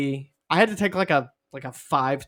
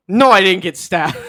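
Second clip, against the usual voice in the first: loud, raised-pitch mock outrage; exasperated denial; 4 semitones higher, 9 dB louder than usual. Crash victim denying being stabbed, mock exasperation. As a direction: snap back, hammed-up indignant. Victim answers an insensitive question.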